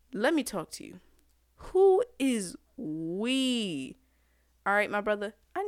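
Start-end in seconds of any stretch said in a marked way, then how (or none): 1.6-4.0 slow drawn-out question. Real, not acted. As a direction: stretch every word, very slow. Ranting at Freud's theory.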